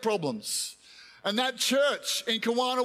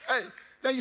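usual speaking voice

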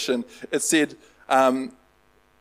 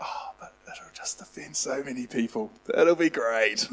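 put-on whispered, like a guilty kid plotting; sneaky whisper; 2 semitones lower, 8 dB quieter than usual. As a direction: hush it as if the wife might hear. Repeats his reply to wife, hushed.

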